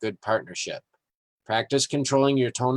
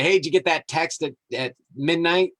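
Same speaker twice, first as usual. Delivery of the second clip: teasing question; 6 semitones higher than usual. Pretend. Suggested teasing question to friend.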